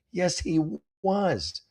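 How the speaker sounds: high, drawn-out protest; exasperated contradiction. Real, not acted. Host contradicting the letter writer about the professor, exasperated. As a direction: object in high-pitched disbelief. Contradicts the line just read.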